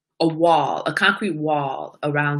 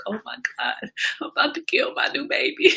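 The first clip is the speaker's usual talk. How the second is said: high, hushed mock panic; retold panic; 6 semitones higher than usual. Owner retelling fright over her puppy, hushed high panic. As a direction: fret in a small, high voice.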